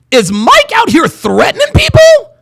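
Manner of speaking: high, loud question of disbelief; incredulous. Real, not acted. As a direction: pitch it way up, scandalized. Incredulous on realizing it's a threat.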